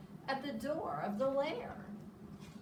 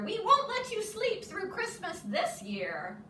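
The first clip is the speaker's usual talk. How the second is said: high eager insisting; higher bright mouse voice; excited; 10 semitones higher, 5 dB louder than usual. Acted. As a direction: keep urging him awake. Mouse keeps urging Bear.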